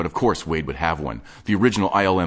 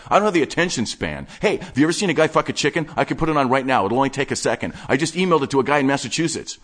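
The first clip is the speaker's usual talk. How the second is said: higher, fast-talking obsessive geek; breathless nerdy enthusiast showing off his stash; manic collector Wade; 7 semitones higher, 3 dB louder than usual. Acted. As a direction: rattle it off like an excited hoarder. Wade again.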